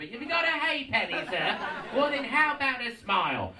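high, loud street urchin voice; Dickensian ragamuffin. Acted. Poor boy begging a gentleman, hammed-up ragamuffin. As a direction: pipe up like a cheeky newsboy. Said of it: "Dickensian ragamuffin now".

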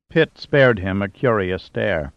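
ordinary delivery, nothing marked

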